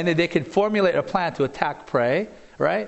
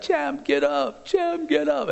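put-on high, slow little-boy plea; imitating pleading toddler; 11 semitones higher, slower than usual. Retelling the boy at the dying boxer's side, imitated childlike plea. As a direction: mimic a small child begging his champ. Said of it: "like a toddler".

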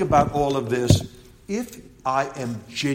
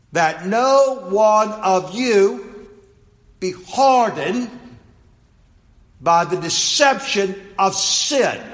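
slow, loud, raised-pitch preaching; emphatic scripture reading; 7 semitones higher, 5 dB louder, much slower than usual. Acted. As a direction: read it word by word, weighing each. Reads scripture aloud emphatically.

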